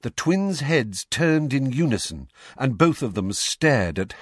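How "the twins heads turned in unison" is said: ordinary delivery, nothing marked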